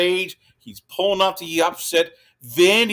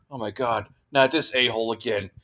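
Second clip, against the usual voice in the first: low, softer mock dismay; imitated dismay; 6 semitones lower, 4 dB quieter, faster than usual. Coach sees the same quarterback back, mock dismay. Voicing Kalen DeBoer's dread of Pavia.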